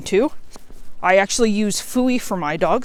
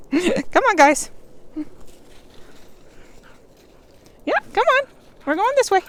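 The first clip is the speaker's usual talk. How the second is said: much higher, bright calling to the dogs; 12 semitones higher than usual. Real, not acted. Owner calls her dogs onward on the trail, high and eager. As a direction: call them along cheerfully.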